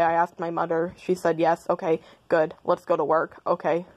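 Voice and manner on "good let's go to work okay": ordinary talk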